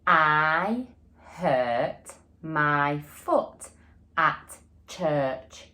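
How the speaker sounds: very slow, word by word, with the class; slow repeat together. Shown as a demonstration. Teacher saying the sentence again with pupils, slow and even. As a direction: lead the class through it slowly. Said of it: "Shall we say it again".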